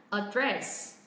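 "a dress" with a low first syllable and stressed, falling DRESS; stress on second syllable. Shown as a demonstration. Teacher models British "address" for learners, second-syllable stress on "dress". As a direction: keep "a" low, put the weight on "dress". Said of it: "hơi xuống giọng âm tiết thứ nhất".

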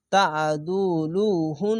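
slow তা আদুলু হুন, the final ন held as a nasal hum; obligatory ghunna. Shown as a demonstration. Teacher reciting the spelt word with ghunna, slow humming হুন. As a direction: draw out each syllable and hum the end of হুন. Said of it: "এখানে ওয়াজিব গুন্না".

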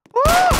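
very high, loud yelp of surprise; sharp high cry; startled yelp. Real, not acted. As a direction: squeak at the sudden enemy. New zombie appears.

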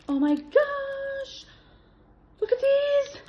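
high, delighted squeal; high squealing gasp of joy at a present; excited squeal. Real, not acted. Unboxing Alice in Wonderland gifts.

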